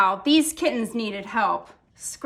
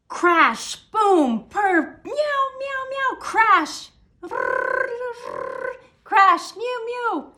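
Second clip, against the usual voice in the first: noisy crash and meow effects; 10 semitones higher, much slower than usual. A narrator's reading. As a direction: act out the crashing and meowing. Sound effects in story.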